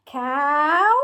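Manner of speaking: normal talking voice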